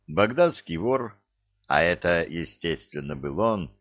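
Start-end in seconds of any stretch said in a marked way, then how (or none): none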